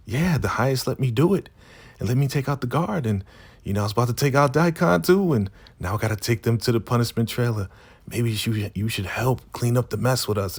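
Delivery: high, fast put-on woman's voice; imitated giddy rambling. Giddy Zane explains to River.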